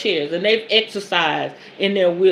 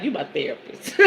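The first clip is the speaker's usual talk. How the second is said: laughing quote; 10 semitones higher than usual. Retold or imitated. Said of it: "He's like".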